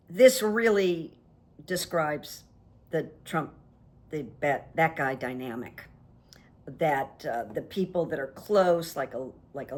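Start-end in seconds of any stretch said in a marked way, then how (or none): none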